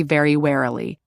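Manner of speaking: plain reading voice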